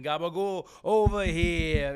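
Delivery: loud, drawn-out mobster quote; Sylv impression. Fan quoting a favorite Sopranos moment, Sylv impression. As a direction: call it out slow and loud, stretching the last words. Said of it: "is Sylv saying".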